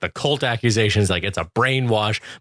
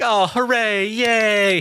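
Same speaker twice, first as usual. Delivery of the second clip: high, drawn-out party cheer; mock cheering; 12 semitones higher, much slower than usual. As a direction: cheer like an overeager party guest. Acts out party guests cheering.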